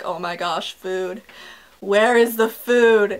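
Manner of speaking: low, faint moan building to a slow demand; mock starving. Sprawled on the couch waiting for food, mock starving.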